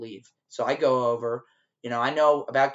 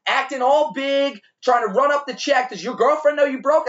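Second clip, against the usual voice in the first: taunting yell; 11 semitones higher, 6 dB louder than usual. Retold or imitated. Waiter chasing the broke customer, yelling a taunting insult. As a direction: shout it to humiliate him in front of his girl. Running toward him taunting.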